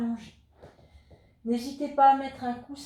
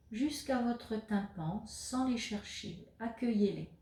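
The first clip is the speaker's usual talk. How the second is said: guided meditation; 3 dB quieter than usual. Acted. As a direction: guide the listener into stillness, leaving space between phrases. Guides a yoga nidra session. (voix posée de méditation guidée, avec de longues pauses)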